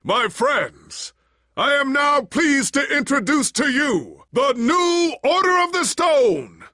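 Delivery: high, loud ceremonial proclamation; grand announcement. Acted. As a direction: announce to the crowd. Public announcement to crowd.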